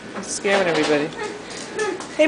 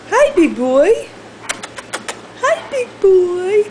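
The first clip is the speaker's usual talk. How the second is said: high pet talk; 9 semitones higher, slower than usual. Real, not acted. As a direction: coo hello to the dog. Cooing to dog.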